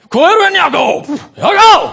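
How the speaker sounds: full-voiced, high shout; calling out. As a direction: bellow through the house for your brother. Esau comes home calling Jakob.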